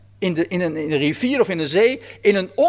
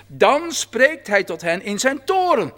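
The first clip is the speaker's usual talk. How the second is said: usual speaking voice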